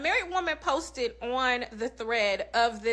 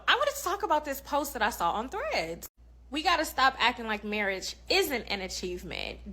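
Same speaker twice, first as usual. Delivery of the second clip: usual speaking voice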